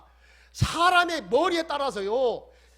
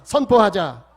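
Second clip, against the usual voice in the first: 선포하자 called out firmly and loudly; loud call to declare; 2 semitones lower, 11 dB louder, slower than usual. Shown as a demonstration. Pastor leading the congregation in repeating, 선포하자 called out loud. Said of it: "따라서 합시다".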